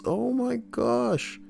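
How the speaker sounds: high, slow exasperated groan; exasperated sigh. Acted. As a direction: drag it out in disbelief. Exasperated reading of chat.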